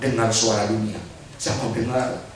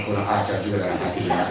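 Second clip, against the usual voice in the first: wry laughing aside; 5 semitones lower, faster than usual. Retold or imitated. Pastor's wry inner reply.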